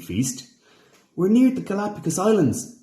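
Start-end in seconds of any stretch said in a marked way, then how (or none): 1.1-2.8 announcing. A narrator's reading. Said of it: "announced Dashi".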